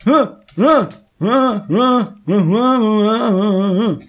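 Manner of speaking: high, rhythmic little cries; repeated high yelps. Real, not acted. Player fleeing danger, repeated high yelps. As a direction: yelp over and over, gradually easing.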